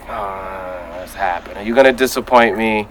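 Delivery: hushed, low, uneasy questioning; acted-out quiet misgivings; wary doubt. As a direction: sink to a soft, doubtful aside. Acting out early doubts about the season.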